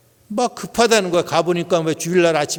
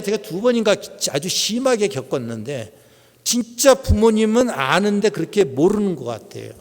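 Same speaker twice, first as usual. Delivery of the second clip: ordinary talk, nothing marked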